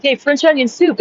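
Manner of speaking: high-pitched retold announcement; cheerful retold remark. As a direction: pitch it up, casually pointing it out. Retells own first remark.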